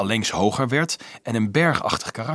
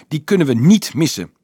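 higher, louder protest; insistent argument; 5 semitones higher, 7 dB louder than usual. A narrator's reading. Boy refusing to give up his cart, insistent. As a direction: argue it firmly, voice raised. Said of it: "betoogde hij".

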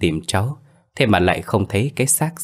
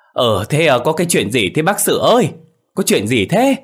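urgent, higher, louder questions; flustered villagers; 7 semitones higher, 4 dB louder than usual. A narrator's reading. Villagers asking grandfather Sự what happened, flustered and urgent. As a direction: crowd rushing out in a fluster. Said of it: "nháo nhào lên".

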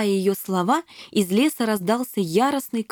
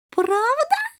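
put-on very high girlish question, rising; wide-eyed wonder. A narrator's reading. Shelli amazed by the kittens' tale, wide-eyed high voice. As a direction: gasp it like an amazed little princess. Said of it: "округлила глаза Шелли".

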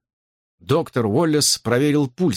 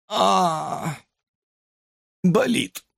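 higher, strained groan of pain; pained moan; 6 semitones higher, much slower than usual. Acted. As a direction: moan weakly, as if badly hurt. Dying man moans in pain. (болезненный стон)

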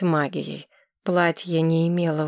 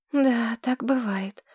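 put-on high, breathless girl gushing in love; dreamy young woman; 5 semitones higher, slower than usual. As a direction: swoon over him like a smitten girl. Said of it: "хмыкнула Тамира".

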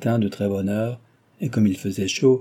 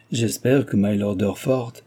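ordinary delivery, nothing marked

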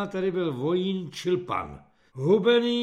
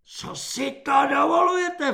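high, loud outraged shriek; 10 semitones higher, 8 dB louder than usual. A narrator's reading. Corporal enraged by a recruit sitting down, shrieking. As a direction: screech the question in outrage. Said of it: "vřískal svobodník".

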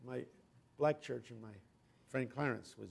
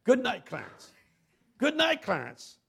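higher, louder, slow triumphant farewell; joyful preaching shout; 8 semitones higher, 16 dB louder, slower than usual. Retold or imitated. Pastor promising the dead man glory, loud and triumphant. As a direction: swell into a victorious promise.

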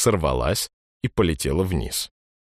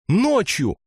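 put-on high, shocked girl's cry; surprised girl; 3 dB louder than usual. A narrator's reading. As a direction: gasp it out in disbelief. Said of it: "Ксюша от удивления". (удивлённый вскрик девочки)

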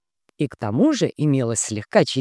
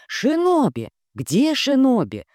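excited women's voices; 11 semitones higher, slower than usual. A narrator's reading. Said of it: "доносились из задней комнаты женские голоса".

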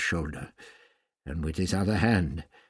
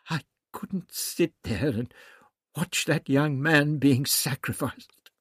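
choked-up, higher-pitched protest; 7 semitones higher, 4 dB louder, slower than usual. A narrator's reading. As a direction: plead slowly as if choked with feeling. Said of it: "Barbara's voice was choked".

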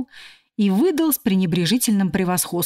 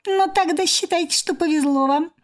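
squeaky cockroach voice; 8 semitones higher than usual. A narrator's reading. Cockroach lecturing as professor.

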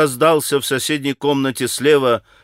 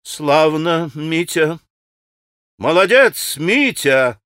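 exclaimed praise with a malicious ring; mildly vicious, ringing exclamation; spiteful cry; slower than usual. A narrator's reading. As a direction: cheer him on with a touch of venom. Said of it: "страшно злобная нотка прозвенела в её восклицании".

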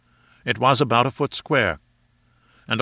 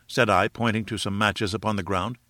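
ordinary delivery

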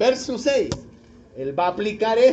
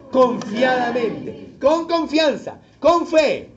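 slow, firm "Confiadamente"; slow and deliberate; 2 semitones higher, 3 dB louder, slower than usual. Shown as a demonstration. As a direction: stretch out "Confiadamente" with conviction. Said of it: "¿Cómo hay que decirlo?". ("Confiadamente", lento y seguro)

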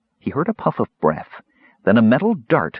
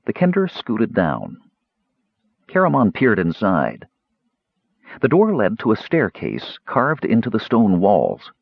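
ordinary delivery, nothing marked

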